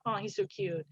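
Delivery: ordinary talk, nothing marked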